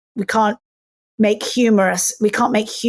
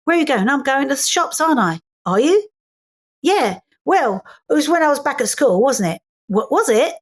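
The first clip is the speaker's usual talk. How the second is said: lilting, questioning Cockney back-and-forth; Cockney accent; 3 semitones higher than usual. Shown as a demonstration. Actress showing Cockneys answering questions with questions, Cockney accent. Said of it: "Cockneys, English people, when you ask them".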